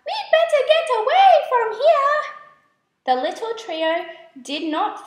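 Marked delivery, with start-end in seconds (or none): 0.0-2.2 much higher, louder and fast, a panicky squeak; frightened squeak. A narrator's reading. Fireflies spot the web and squeak in fear, high fast panic. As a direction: squeak it out in a rush like scared tiny fireflies. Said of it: "squeaked in fear".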